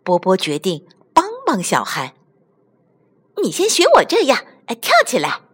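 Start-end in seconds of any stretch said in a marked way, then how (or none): 3.4-5.4 quick, high-pitched kangaroo toy; eager demonstrating. Acted. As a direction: urge a friend to copy you. (又高又快地热心示范)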